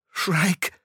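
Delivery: höher, verächtlich geschnaubtes „Shrike“; stoß „Shrike“ spöttisch hervor; schnaubend. A narrator's reading. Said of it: "schnaubte Hester". (Hester schnaubt Shrike verächtlich an)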